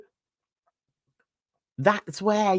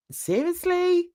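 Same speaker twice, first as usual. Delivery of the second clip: pitched way up in disbelief; a high, stunned seriously?; incredulous; 9 semitones higher than usual. Real, not acted. Disbelief at his politics plan.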